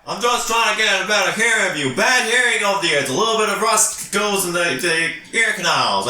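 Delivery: high, hammed-up excuse; hard-of-hearing character. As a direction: explain yourself theatrically to the guard. Player excuses himself in character.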